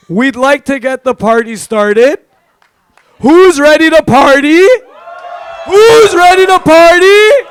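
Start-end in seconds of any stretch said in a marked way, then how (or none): 3.2-4.6 rowdy party animal. Acted. Hyping crowd to party. 5.7-7.5 higher, louder hype yell; rowdy party animal. Acted. Repeats hype, bigger.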